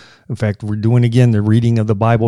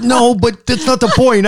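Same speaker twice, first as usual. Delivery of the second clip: whiny retort; 11 semitones higher than usual. Retold or imitated. Wife retorting in acted quarrel.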